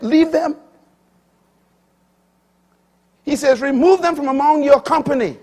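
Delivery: high, loud pulpit delivery; full-force sermon voice; fiery preaching. Acted. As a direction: preach it out to the congregation.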